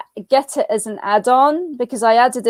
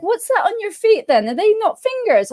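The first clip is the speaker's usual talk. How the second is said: higher-pitched posh British voice, imitated; posh accent; 8 semitones higher than usual. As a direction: imitate him asking in a really posh accent. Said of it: "saying it with a really posh accent".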